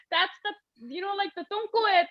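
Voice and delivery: talking through laughter. Real, not acted. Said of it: "laugh while crying".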